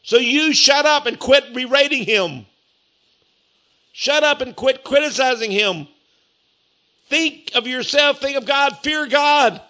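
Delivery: high, forceful preacher's rebuke; retold indignant telling-off; rebuking. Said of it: "He rebuked him".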